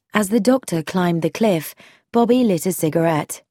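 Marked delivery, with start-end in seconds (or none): none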